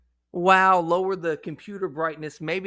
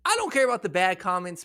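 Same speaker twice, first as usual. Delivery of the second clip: normal talking voice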